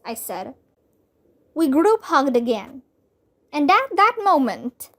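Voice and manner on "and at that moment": ordinary delivery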